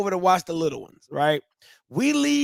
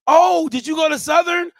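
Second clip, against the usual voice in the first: higher, louder eager question; friendly stranger greeting; 8 semitones higher, 5 dB louder than usual. Acted. Stranger spots a Southern hoodie, excited greeting. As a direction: act out a delighted hoodie-spotter. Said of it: "it would be like".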